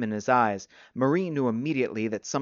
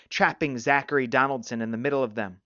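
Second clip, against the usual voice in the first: usual speaking voice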